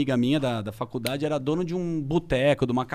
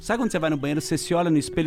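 normal talking voice

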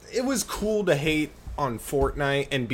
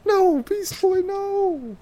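much higher, scolding cartoon voice; much slower than usual. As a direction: scold Beast Boy in a squeaky voice. High scolding character voice.

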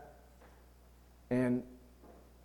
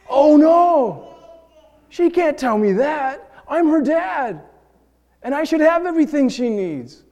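indignant father; 13 semitones higher, 8 dB louder than usual. Retold or imitated. Voices his wounded father pride.

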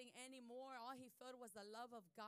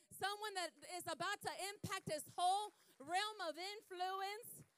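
loud, high, impassioned preacher voice; full-force preaching; 6 semitones higher, 10 dB louder than usual. Real, not acted. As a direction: proclaim it to the whole congregation.

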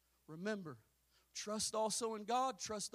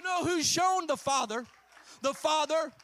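preaching shout; 7 semitones higher, 10 dB louder than usual. Real, not acted. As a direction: shout it over the house. Preaching climax.

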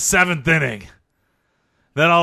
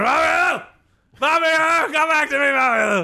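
high hammed-up cries of the name; mock screaming; 8 semitones higher than usual. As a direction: wail the name from a nightmare, overplayed. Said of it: "I wake up screaming".

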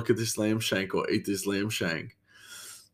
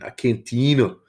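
put-on South American accent; 6 semitones higher, 4 dB louder than usual. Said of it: "like, a South American thing".

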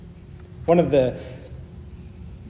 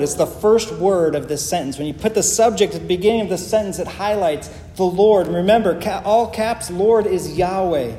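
ordinary talk

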